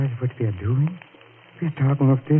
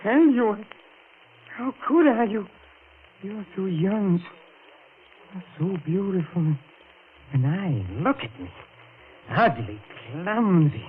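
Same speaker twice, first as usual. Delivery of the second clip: higher, pouring out feeling; impassioned confession; 8 semitones higher than usual. Acted. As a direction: confess it all in a rush of emotion. Passionate confession of love.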